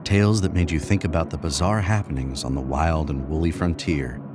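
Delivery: plain reading voice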